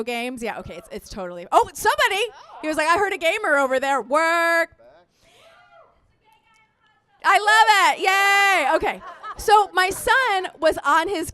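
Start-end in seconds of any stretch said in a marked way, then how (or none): 2.9-4.8 mock hype with a held-out last word; playful callout; cheering audience member. 7.2-8.8 loud, high, drawn-out yay; gleeful shout; delighted cheer. Real, not acted. Cheers the audience on.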